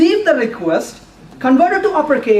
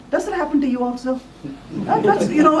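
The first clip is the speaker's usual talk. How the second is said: ordinary talk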